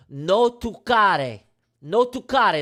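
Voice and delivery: no toccare drawn out slow and loud, in a hammed-up Italian accent; Italian saying. Shown as a demonstration. Streamer warns chat off the charts, in a hammed-up Italian accent. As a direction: say no toccare like an Italian nonno warning you off. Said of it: "This is how you say this in Italian".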